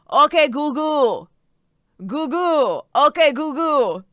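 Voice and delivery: Italian nonna mishearing Okay Google; Italian grandma accent. Retold or imitated. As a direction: mimic an old Italian grandmother fumbling the words. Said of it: "this old Italian grandma going".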